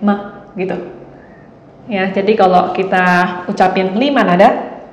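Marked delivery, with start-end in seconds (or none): 0.0-0.4 short, light toneless "ma"; neutral tone. Shown as a demonstration. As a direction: say "ma" quick and toneless. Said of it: "gak ada nadanya". ("ma" pendek dan ringan tanpa nada)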